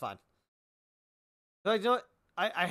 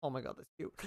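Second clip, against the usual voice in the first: normal talking voice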